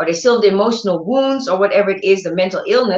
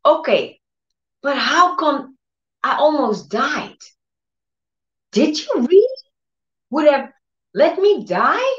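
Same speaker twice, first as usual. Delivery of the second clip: distressed questioning; 6 semitones higher than usual. Retold or imitated.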